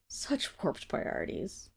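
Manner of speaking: slow, whispered scorn; under-breath sneer; muttered disdain. Acted. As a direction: mutter it aside with contempt. Scornful aside.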